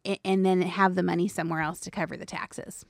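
ordinary talk, nothing marked

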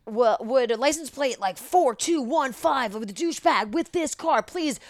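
high, slow-counted megaphone call-out; mock public announcement. Clown reading the plate over a megaphone, acted sarcastic announcement. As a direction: imitate paging a car owner, with mock politeness. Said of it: "I'm like, reading license plate".

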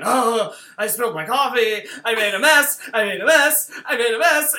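loud, mounting panic, hammed-up; frantic meltdown. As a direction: imitate someone falling apart over a mess. Acting out character's coffee-spill meltdown.

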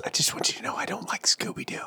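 high, soft, near-whispered admission; small, high, whispery voice; hushed confession. Real, not acted. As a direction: confess it timidly under your breath. Sheepish confession after friend's outburst.

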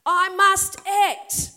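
loud and slow, each word hammered; voicing God's urgent resolve; urgent declaration. Shown as a demonstration. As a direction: proclaim it like a call to action. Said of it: "This is an emergency".

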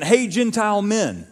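high and loud, calling out the crowd; mock demanding preacher. Legalists address Gentile believers with a high loud call. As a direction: hail the Gentiles like a stern teacher.

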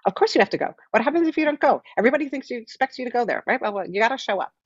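high, fretting inner voice; anxious inner chicken voice nagging you to go. Retold or imitated. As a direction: squawk it out like a nervous chicken. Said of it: "it's like that chicken voice".

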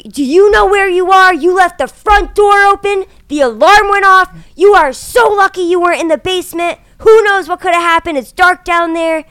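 loud, high lecturing officers; scolding cops. Retold or imitated. Cops scolding the realtor in front of clients, loud. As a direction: dress her down like a rookie. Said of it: "They were like".

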